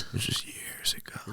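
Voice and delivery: barely voiced, slow near-whisper; hushed admission. Real, not acted. Owns up about the ex's hat, hushed. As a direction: let it slip out very quietly, drawn out.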